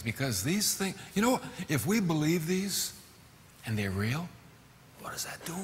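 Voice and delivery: usual speaking voice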